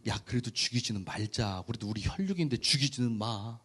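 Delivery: low, soft restraining voice; subdued pleading. Acted. Eldest brother pleading to spare Joseph, low and subdued. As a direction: quietly talk them down.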